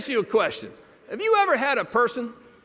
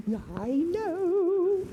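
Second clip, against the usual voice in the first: high, slow, drawn-out mock delivery; mimicked secretary; 6 semitones higher, 4 dB quieter, much slower than usual. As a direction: mimic her, stretching it out high and rising. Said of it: "she said this to me".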